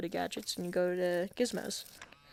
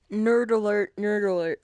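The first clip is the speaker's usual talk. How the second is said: loud mock alarm; 9 dB louder than usual. Mock warning before technical part.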